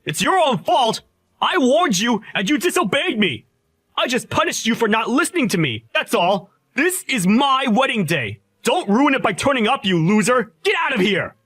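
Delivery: high, loud enraged yelling; furious shout. Acted. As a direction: scream him out of your wedding. Groom screaming him out of wedding.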